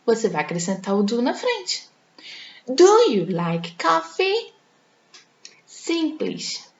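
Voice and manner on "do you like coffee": slow, high rising question, "Do" pitched up; teacher modelling question intonation; question tune. Shown as a demonstration. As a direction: lift it into a clear question. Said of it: "E a pergunta".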